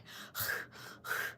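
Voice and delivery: short puffing breaths, demonstrated; imitated hyperventilating. Shown as a demonstration. She shows crying to her friend, hyperventilating. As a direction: mimic gasps from hysterical crying. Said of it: "that high ventilating or something like that".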